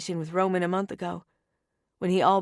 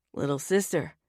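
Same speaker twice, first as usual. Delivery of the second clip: brother's voice; 5 semitones lower than usual. Acted. Tony voiced by narrator.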